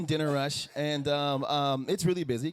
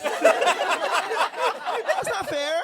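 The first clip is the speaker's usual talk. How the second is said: very high, slow, loud whining; drawn-out whine; 7 dB louder, much slower than usual. Retold or imitated. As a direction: stretch it out like a kid wailing. Whining, stretched out.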